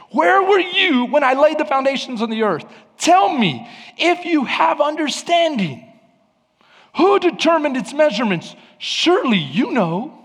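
high, emphatic challenge as God; sarcastic frustration. Acted. God questions Job about creation, sarcastic and emphatic. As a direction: press him with mock-expectant questions. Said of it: "sense God's sarcasm and frustration with him".

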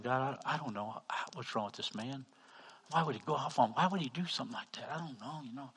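very soft, puzzled praying; half under the breath, asking God why; acted private prayer. Preacher prays about the man in line, hushed bewilderment.

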